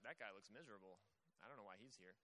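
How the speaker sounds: a stranger tossing off a remark; passerby. Retold or imitated. Random passerby sneering at Job, low and quiet. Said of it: "random passerby who's like".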